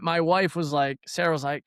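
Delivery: ordinary delivery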